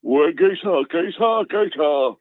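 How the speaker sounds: high-pitched mock builder patter; comic impersonation, pitched well up; Tommy Walsh. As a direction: repeat it in a high put-on geezer voice. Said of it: "who this is behind his voice".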